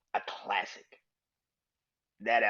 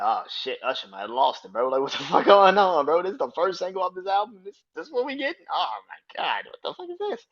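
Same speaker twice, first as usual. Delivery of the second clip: rising, louder incredulous fan rant; mock outrage; 8 semitones higher, 7 dB louder than usual. Fans reacting to [name]'s first single, building outrage. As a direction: go higher and higher in disbelief. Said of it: "I remember people being like".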